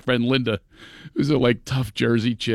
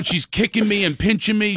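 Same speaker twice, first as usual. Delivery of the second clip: ordinary talk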